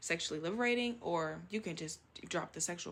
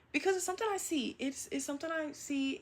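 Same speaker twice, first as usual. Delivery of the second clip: exclaimed; 8 semitones higher, 3 dB louder than usual. Real, not acted. Emphatic exclaim about campus.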